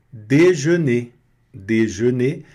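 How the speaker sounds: déjeuner with oral eu, no nasal an; déjeuner said slowly, eu not nasal; French déjeuner. Shown as a demonstration. Teacher modelling déjeuner for students, slow. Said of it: "Você não vai pronunciar an".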